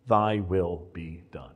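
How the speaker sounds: low, slow, hushed reverence; solemn quoted prayer. Retold or imitated. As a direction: quote a prayer softly, each word given weight. Quoting elder's solemn hospital prayer.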